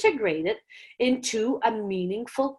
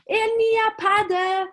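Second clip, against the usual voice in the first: put-on much higher, keen kid voice; student correcting; 10 semitones higher than usual. A keen student correcting the teacher in a much higher voice. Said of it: "students that will say".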